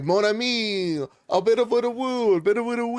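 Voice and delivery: high, hammed-up French accent; mock French accent. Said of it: "they're like".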